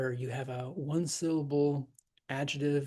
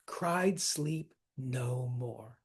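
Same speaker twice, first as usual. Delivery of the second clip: slow, every word of "Cried sleep no more" stressed; "Cried sleep no more" as two heavy spondees; 2 semitones lower, much slower than usual. Shown as a demonstration. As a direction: hit all four words evenly and slowly. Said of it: "two spondees in a row".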